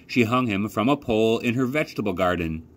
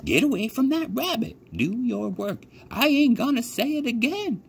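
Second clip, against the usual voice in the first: put-on old country woman. A narrator's reading. Old lady tells the scarecrow rabbit he'll scare birds, high old voice. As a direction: sound like a rough old farm woman. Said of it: "said Bryce".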